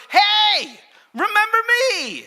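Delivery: very high, loud calling out; excited shout. Acted. Hannah hails the priest years later, excited high shout. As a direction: holler it to get his attention.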